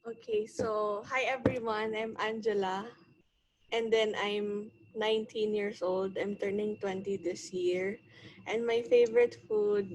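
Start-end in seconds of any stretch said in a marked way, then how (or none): none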